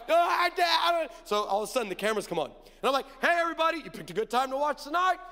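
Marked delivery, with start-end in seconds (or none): 0.1-1.1 high, loud mock wail; hammed-up sobbing protest; imitated crying. Dad imitating daughter crying as cameras approach, high wail. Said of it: "gonna see you cry". 3.2-5.2 high, upbeat on-air welcome; TV host greeting. Retold or imitated. Host greeting viewers as cameras come on, upbeat presenter.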